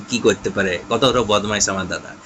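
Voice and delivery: ordinary talk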